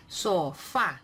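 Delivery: Sofá with the final fá stressed; Sofá said with a strong final A. Shown as a demonstration. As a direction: punch the last A of Sofá. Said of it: "suena más fuerte la A".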